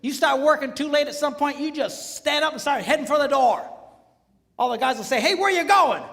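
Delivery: high and loud preaching; fiery preaching, loud and high; preaching at full force. Real, not acted. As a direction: keep the fire going.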